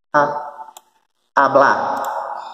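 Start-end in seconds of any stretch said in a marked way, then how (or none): 1.4-2.5 "Hablar" said as a-BLÁ, last syllable stressed; "Hablar" ending on a strong A. Shown as a demonstration. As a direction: lean on the final A of "Hablar". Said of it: "com a sílaba tônica no último A".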